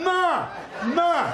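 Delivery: put-on high, loud, whining toddler calling for mom; toddler with stuck tongue. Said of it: "I'd be like".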